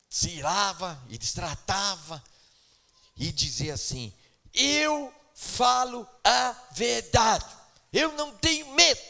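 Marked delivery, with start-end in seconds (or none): 4.5-9.0 mock defiant sister. Mocking a quarrelsome sister.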